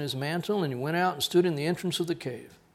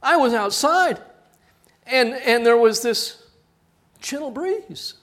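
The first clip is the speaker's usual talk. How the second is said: much higher, loud breathless awe; mock awestruck student; 9 semitones higher, 9 dB louder than usual. Student gushing to parents about a breeze, high and awed. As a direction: marvel as if it were a miracle.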